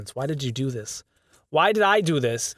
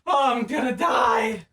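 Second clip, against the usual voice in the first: mock despair; 6 semitones higher, slower than usual. Despair at long recording.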